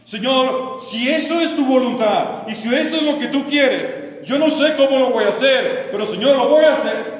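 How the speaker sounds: loud, high cry to God; fervent vow. Acted. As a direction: declare it with full preaching force. Models believer's prayer of full commitment.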